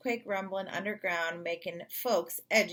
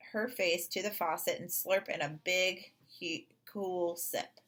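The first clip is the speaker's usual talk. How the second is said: usual speaking voice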